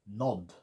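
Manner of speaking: nod said short and clipped; short vowel. Shown as a demonstration.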